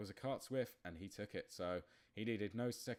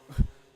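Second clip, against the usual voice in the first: burst of laughter; 16 dB louder than usual. Real, not acted. Contact in the race.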